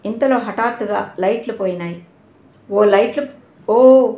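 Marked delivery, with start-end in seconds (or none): 2.7-4.1 rising startled shout in the dark; shouted alarm, louder on the second cry; crowd shouting. Acted. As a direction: cry out as the lights die. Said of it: "hall అంతా అరుపులతో".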